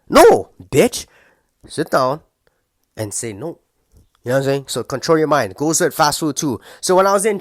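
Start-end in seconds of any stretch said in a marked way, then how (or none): 0.1-3.6 sharp loud rebuke. Acted. Shutting down the tempted viewer, sharp and loud. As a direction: cut him off hard.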